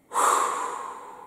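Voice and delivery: voiceless blown-out breath; empty the lungs with a whoosh; long exhale. Shown as a demonstration. Coach shows pupils the out-breath, long exhale. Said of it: "Donc j'expire".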